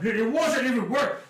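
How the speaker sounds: high, strained plea to let go; panicked elf. Acted. Fleeing elf begs the halfling to let go, high and frantic. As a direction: elf yanking free, pleading in a high voice. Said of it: "it's like".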